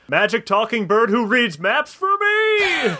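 high, drawn-out theatrical call; rogue's shout. Acted. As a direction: call out grandly, stretching the words. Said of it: "shouted the rogue".

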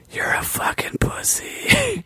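a ghostly wind whispering; eerie whisper. A narrator's reading. Wind whispering an insult to Gregory, eerie whisper. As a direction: breathe it out like a haunted wind. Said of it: "seemed to say".

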